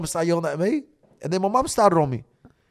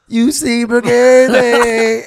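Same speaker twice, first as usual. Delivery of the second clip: loud, higher, stretched-out call; drawn-out shout; 4 semitones higher, 11 dB louder, much slower than usual. Retold or imitated. Telling the brother on the podcast, drawn-out shout. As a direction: holler it, dragging out each word. Shouted greeting retold to a mate.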